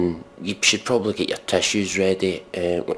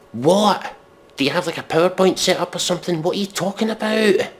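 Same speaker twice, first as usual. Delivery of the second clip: very high, incredulous and fast; high-pitched what-are-you-on disbelief; baffled outrage; 11 semitones higher than usual. Real, not acted. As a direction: squeak out total bewilderment. Baffled at lyric.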